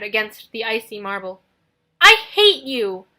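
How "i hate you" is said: very loud, slow, stressing I; hateful shout. Acted. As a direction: hurl it at him with hatred. Nico shouts hatred.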